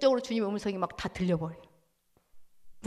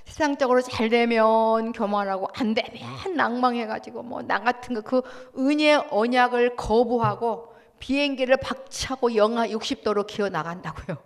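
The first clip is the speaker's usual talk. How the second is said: ordinary talk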